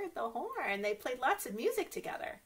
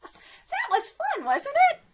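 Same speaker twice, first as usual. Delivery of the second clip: laughing; 10 dB louder than usual. Real, not acted. Laughing after story.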